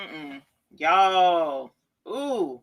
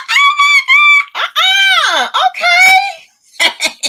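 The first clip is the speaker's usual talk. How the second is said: squealing laugh; 12 dB louder, much slower than usual. Real, not acted. Laughing at her reflection.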